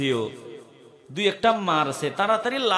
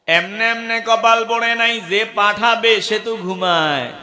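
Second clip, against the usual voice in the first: higher, louder sermon voice; fiery preaching; 7 semitones higher, 9 dB louder than usual. Acted. Preacher scolding sleeping parents, raised and forceful. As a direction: drive it at the crowd.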